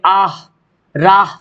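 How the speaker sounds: आह, राह ending on an audible "h"; clearly sounded "h". Shown as a demonstration. Teacher showing students the sounded letter, आह, राह with clear "h". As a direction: breathe out the final "h" in आह, राह. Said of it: "साफ साफ जाहिर हो".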